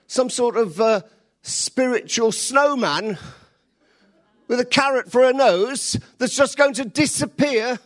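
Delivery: loud, high preaching voice; full-force preaching. Acted. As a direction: preach it big to the crowd.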